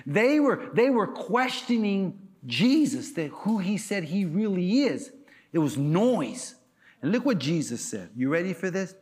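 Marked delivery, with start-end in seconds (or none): none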